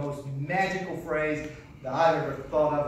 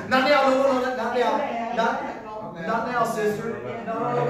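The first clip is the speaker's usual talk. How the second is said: loud, high mock no-no; mock shooing; 6 semitones higher, 7 dB louder, much slower than usual. Preacher pretending to shoo a sister off stage, loud. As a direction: wave someone off playfully.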